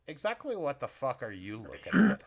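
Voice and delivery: gruff challenge to a stranger; gruff short guy. Acted. Kitchen worker challenging a newcomer, gruff. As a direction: confront him like a gruff little man. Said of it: "a gruff, short guy comes in".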